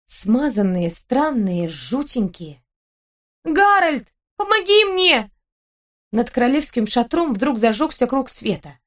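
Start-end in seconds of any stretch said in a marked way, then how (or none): 3.4-4.1 high, drawn call for a companion. Acted. Girl lost at night calls for Harold, calling out high. 4.4-5.3 high, pleading call; calling for help. Acted. Girl lost in the dark calls to Harold, high call for help.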